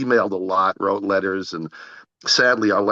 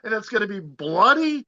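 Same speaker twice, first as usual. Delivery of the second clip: much higher, squealing with delight; thrilled disbelief. Retold or imitated. Telling the director he would not dream of rescheduling, overjoyed and much higher. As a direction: gush like a kid handed the best present.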